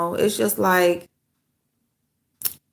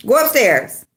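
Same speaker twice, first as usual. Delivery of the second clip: raised, commanding order; firm command; 5 semitones higher, 4 dB louder, slower than usual. Real, not acted. Owner ordering her dog upstairs, firm raised command. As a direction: send the dog upstairs firmly.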